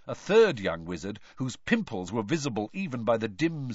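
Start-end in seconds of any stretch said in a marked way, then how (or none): none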